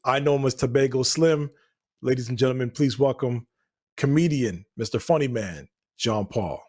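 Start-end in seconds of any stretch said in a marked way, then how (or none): none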